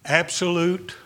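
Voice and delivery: ordinary talk, nothing marked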